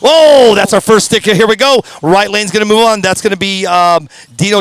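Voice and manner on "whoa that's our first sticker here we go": excited. Real, not acted. First sticker appears on screen.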